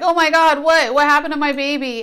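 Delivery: high, loud alarmed cry; mock-panicked mother hearing bad news; worried shout of alarm; panicked gasp. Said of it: "And you know me, I'm like".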